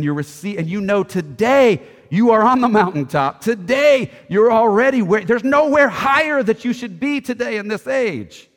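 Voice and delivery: loud, high, soaring preacher's proclamation; preaching at full force. Acted. Preacher rousing the congregation, full-force proclamation. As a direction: thunder it out to the whole church.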